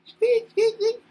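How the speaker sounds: very high squeaky mouse noises; a mouse going squeak, very high. Pretend. Imitating computer mouse.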